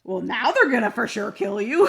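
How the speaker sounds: hammed-up outburst of exasperation; exasperated shout. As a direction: yell it at the gnome in disbelief. Acted scolding of the gnome.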